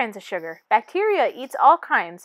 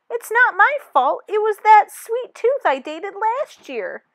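higher, defensive whine; blaming; 8 semitones higher, 5 dB louder than usual. Acted. Tooth blaming its ex sweet tooth, higher defensive whine. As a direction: shift the blame, all indignant.

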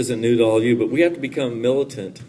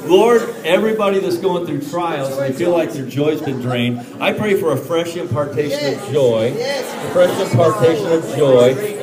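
loud, rising preacher's prayer; preacher praying loudly over the congregation; fervent loud prayer; 6 semitones higher, 7 dB louder than usual. Real, not acted. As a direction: pray over them with building force.

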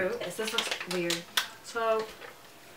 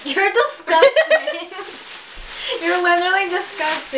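high loud disgusted squeal; disgusted shriek; 8 semitones higher, 3 dB louder than usual. Real, not acted. Friend eats booger bean.

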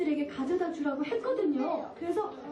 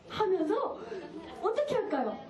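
plain reading voice

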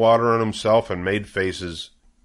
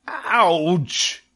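much higher sudden cry of pain; screamed; 9 semitones higher than usual. A narrator's reading. Wilbur screaming as the rat bites his tail. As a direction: yelp from the bitten tail. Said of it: "he screamed".

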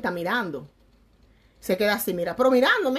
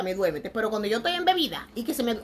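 usual speaking voice

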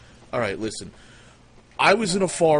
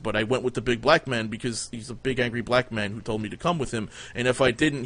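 usual speaking voice

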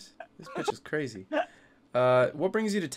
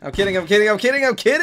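panicked plea; 7 semitones higher, 6 dB louder, faster than usual. Acted. Panicked plea, gun pointed at him.

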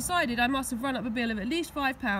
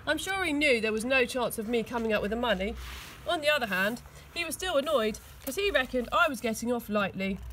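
plain reading voice

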